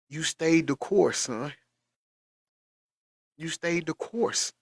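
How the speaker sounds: slow, tender and sincere; slow, moved praise; heartfelt. Real, not acted. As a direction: tell him gently how proud you are. Emotional praise of son.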